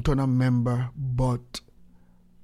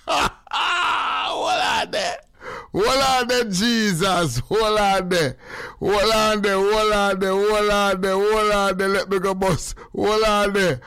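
excited; 5 semitones higher, slower than usual. Acted. Stopping himself mid-sermon, excited shouted chant. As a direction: keep crying out the hold-on chant like a revival. Mock preacher hyping himself.

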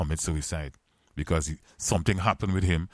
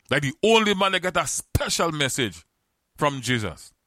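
high, louder preaching call to the congregation; full-force preaching; 9 semitones higher, 3 dB louder than usual. Acted. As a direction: lift the voice and plead with the crowd to remember Peter.

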